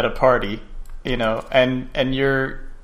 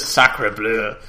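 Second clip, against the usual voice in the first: lower, lightly put-on French accent; a quick cliché French accent, lower; mock French accent; 4 semitones lower than usual. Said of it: "no French person ever says".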